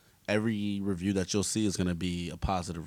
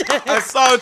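laughing hard; 15 dB louder than usual. Real, not acted. Cracks up at a pun.

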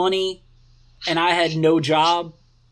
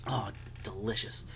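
softer, just swallowed tea; after sipping a drink; 7 semitones lower, 15 dB quieter than usual. Real, not acted. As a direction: savor it after the sip. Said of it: "let me take a sip of tea".

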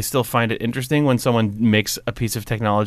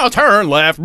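higher, sing-song robotic sat-nav; auto-tuned GPS voice; 6 semitones higher, 4 dB louder, slower than usual. Retold or imitated. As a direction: chirp it like a cheerful robot navigator. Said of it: "it's all like".

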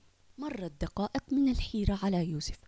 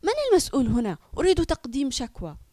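loud, higher angry demand; furious mother; 4 semitones higher, 7 dB louder, faster than usual. Acted. Mother demanding a complaint, furious and loud. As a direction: demand the manager in anger. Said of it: "غضبت سمية بشدة فقالت".

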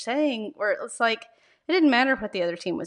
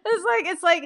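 giggling, pitched higher; laughing; 10 semitones higher, 4 dB louder than usual. Real, not acted. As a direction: laugh it out while talking. Laughing at own tennis story.

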